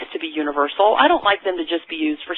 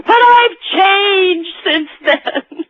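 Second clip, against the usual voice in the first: very slow and high, drawn-out plea; hammed-up pleading; 13 semitones higher, 4 dB louder, much slower than usual. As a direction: stretch it out, mock-sincere. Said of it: "Or this one".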